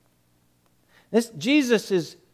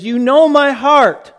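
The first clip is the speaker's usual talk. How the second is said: loud, higher outburst; upset; 6 semitones higher, 6 dB louder than usual. Retold or imitated. Peter upset at Jesus asking again, upset outburst. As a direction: burst out in protest. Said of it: "Peter's upset now".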